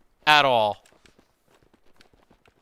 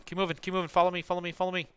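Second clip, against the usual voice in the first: ordinary talk, nothing marked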